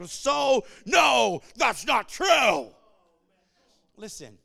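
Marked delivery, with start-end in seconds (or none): 0.0-2.7 loud, high mock protest, drawing out the last word; shouted objection. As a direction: shout them down as an indignant objector. Acts out scoffing objectors.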